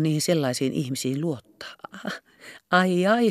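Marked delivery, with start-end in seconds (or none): none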